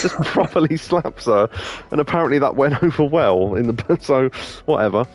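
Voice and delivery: normal talking voice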